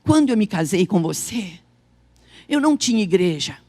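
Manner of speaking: low, measured voice of a husband; voiced husband. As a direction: quote him gravely, pitched well down. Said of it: "Ele olhou, ele disse assim".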